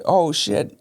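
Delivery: high, lightly voiced little girl; a hint of a toddler blurting it out; toddler's quote. Toddler daughter cussing in the car, lightly voiced, high.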